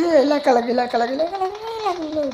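high, rising panicky cry; rapid repeated alarm, pitch shooting up; panicked yelp. Real, not acted. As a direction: yelp it out as danger hits. Sudden danger in the game.

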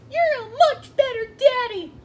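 very high, loud little-creature voice; Pikachu. Acted. Sidekick praising his daddy, adoring squeak. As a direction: gush like an adoring tiny child. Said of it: "Sure, Pikachu".

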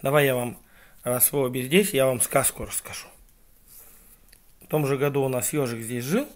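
ordinary delivery

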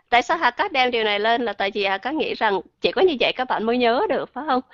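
normal talking voice

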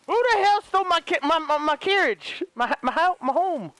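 high, stammering disbelief; who took my home, stunned; shocked outrage. Real, not acted. Finds camp gone.

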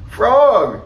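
high, loud call to a friend; crying out. A narrator's reading. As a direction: call out from the stage. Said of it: "cried Toad".

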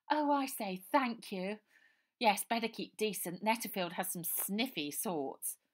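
high, loud plummy lady; posh voice. A narrator's reading. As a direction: sigh it like a posh girl. Posh girl thanks Baby.

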